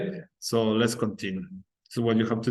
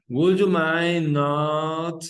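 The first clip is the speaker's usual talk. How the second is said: very slow, mind held long, a touch higher and louder; slow stretched model phrase; 5 semitones higher, 5 dB louder, much slower than usual. Shown as a demonstration. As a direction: stretch it out for the class to copy. Teacher models the negative form.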